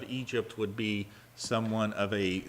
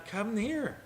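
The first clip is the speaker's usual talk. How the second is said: gentle invitation; 12 semitones higher than usual. Acted. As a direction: beckon them in gently. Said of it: "and he says".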